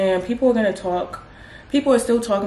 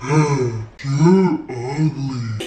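much lower, gravelly-free dumb troll voice dropping to a mutter; low mock troll voice sneering you're ugly; mock troll insult; 7 semitones lower, much slower than usual. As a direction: growl it out like a dim troll. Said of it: "they just say some dumb shit like".

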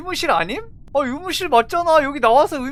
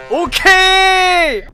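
excited drawn-out okay; 7 semitones higher, 11 dB louder, much slower than usual. Real, not acted.

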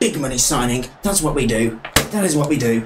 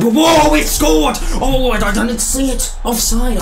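high, loud disbelieving yell; startled goal shout; 10 semitones higher, 6 dB louder than usual. Real, not acted. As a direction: burst out in shock at the goal. Surprised goal, then offside call.

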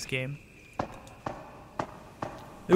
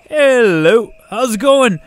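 much higher and louder, yelled hello to a viewer; loud yelled greeting; 9 semitones higher, 12 dB louder, slower than usual. Real, not acted. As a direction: shout the greeting across the room. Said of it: "I yelled that really loud".

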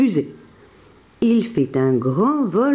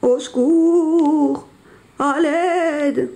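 high, long-drawn wail for help; mock wounded moaning; 8 semitones higher, much slower than usual. Jean-Claude fakes injury to lure the bison, wailing. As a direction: moan as if hurt, hammed-up. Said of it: "se met à gémir, comme s'il était blessé".

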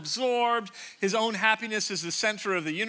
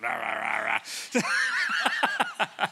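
low mock growl of rah rah, a bit shouted; mock grumbling rage; 8 semitones lower than usual. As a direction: grumble like a sulking brother. Voicing the angry older brother.

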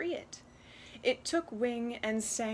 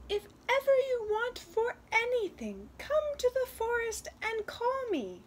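high little wren voice; little talking bird; 14 semitones higher, 4 dB louder than usual. Acted. Wren offers the girl future help, high bird voice. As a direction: make a promise as a tiny magic bird. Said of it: "the bird said".

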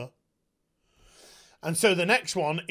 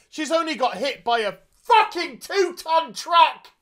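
higher, louder disbelieving rant; exasperated rant; 9 semitones higher, 5 dB louder than usual. Real, not acted. Reviewer ranting about the truck hit, exasperated. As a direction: vent at the bad writing.

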